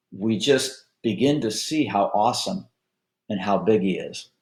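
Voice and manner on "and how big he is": ordinary delivery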